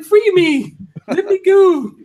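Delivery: high, loud mock plea for mercy; begging hat. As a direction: squeal it as the trapped hat. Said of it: "that hat is begging for mercy. ... it's like".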